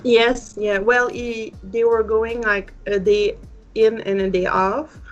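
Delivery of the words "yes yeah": ordinary talk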